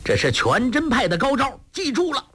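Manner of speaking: high-pitched boastful call; triumphant fighter showing off his move; taunting call. A narrator's reading. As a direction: crow it high at your opponent. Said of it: "口中叫道".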